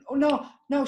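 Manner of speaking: ordinary delivery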